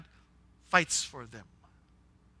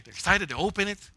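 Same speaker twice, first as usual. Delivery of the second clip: ordinary talk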